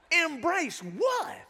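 high, slow, drawn-out disbelief; incredulous retort. Retold or imitated. Frustrated man answering his friend's advice, incredulous. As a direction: pitch it way up and stretch the question.

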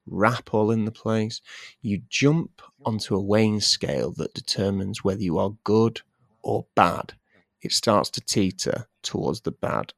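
ordinary talk, nothing marked

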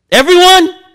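high, loud cry; pulpit shout. Acted. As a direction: hit the whole room. Preaching shout.

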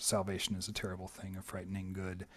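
put-on a quiet, grave old woman; hushed. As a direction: say it low like a solemn secret. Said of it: "Missus Anstruther said".

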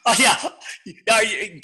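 ordinary talk

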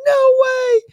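very high squeaky disbelief; mock squeal. Host reacting to the news in fake shock. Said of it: "mock disbelief".

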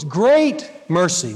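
"great mercy" slow, each word weighed; stress on great mercy. Shown as a demonstration. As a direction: lean on "great mercy", drawn out. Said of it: "here's the key words".

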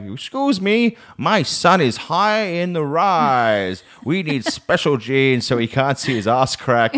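high, slow mum impression; drawn-out, hammed-up motherly request; imitated embarrassing mum. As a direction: play mum announcing it to a shop assistant. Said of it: "she'd walk straight up to the counter and be like".

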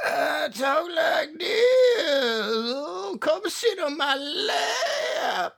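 high, very slow creepy drawl; slow, oily invitation from a creepy cult leader; leering old lecher. Retold or imitated. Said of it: "He was just like".

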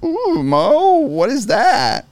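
high, slow, delighted "Ooh"; swooning woman's gasp; swooning wife. Retold or imitated. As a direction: gush like she's impressed. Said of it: "some lines where she's gonna like".

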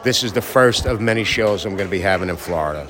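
normal talking voice

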